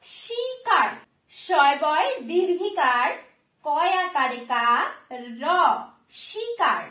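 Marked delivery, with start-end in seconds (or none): none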